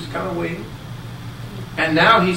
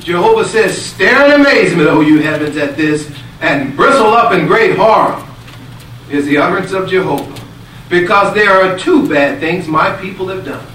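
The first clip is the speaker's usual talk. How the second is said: full-force preaching; 9 dB louder than usual. Acted. Preacher telling the blessing story to the congregation, at full force. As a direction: drive it home to the congregation.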